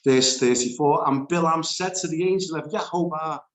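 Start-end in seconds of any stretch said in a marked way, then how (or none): none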